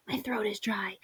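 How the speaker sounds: whispered sickly complaint, hammed up; weak, dry whisper. Child listing ailments in poem, weak whisper. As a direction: drop to a faint, dry-throated whisper.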